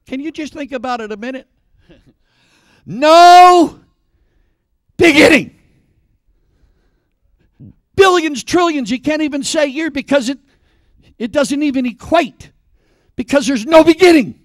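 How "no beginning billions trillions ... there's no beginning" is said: high, loud, emphatic preacher's cry; full-force preaching. Real, not acted. Preacher pressing God's endlessness on the congregation, loud and fervent. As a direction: hammer it out to the back pew. Preacher hammering home his point.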